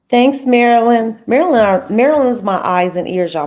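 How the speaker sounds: loud, enthusiastic shout-out; excited thanks. Real, not acted.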